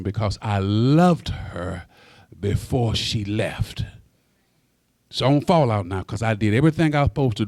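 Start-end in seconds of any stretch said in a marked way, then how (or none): 0.0-3.8 slower, heavier repetition of the line; slow, mournful restatement; slow grieving repeat. Real, not acted. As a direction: let the grief weigh each word. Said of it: "Uh-uh".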